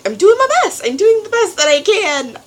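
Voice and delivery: high, loud exasperated cry; frustrated outburst. Real, not acted. Student driver venting about her instructor, high and exasperated. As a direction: vent in exasperation.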